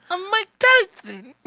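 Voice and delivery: high lisping boxer voice; Mike Tyson impression. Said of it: "do you do anybody's impersonations".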